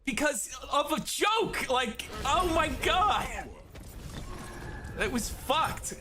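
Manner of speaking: high, indignant rant with shouts; outraged rant. Real, not acted. Ranting about unfair punishment.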